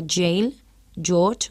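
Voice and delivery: "jail, George" opened with a dzh sound; English J sound. Shown as a demonstration. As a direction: model "jail, George" with a hard English j, word by word. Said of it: "otro sonido típico".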